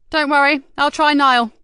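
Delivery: lightly voiced urgent woman; brisk woman voice. Yvonne's voice.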